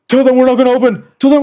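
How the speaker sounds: imitated yell from the back room; high, loud, insistent order; yelling coworker.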